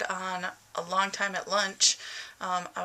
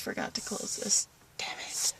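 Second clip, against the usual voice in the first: whisper; 5 dB quieter than usual. Real, not acted. Forgot to close something, muttering.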